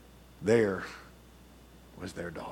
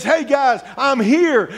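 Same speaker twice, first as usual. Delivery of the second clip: calling out; 13 semitones higher, 7 dB louder, slower than usual. Acted. Peter banging on the gate.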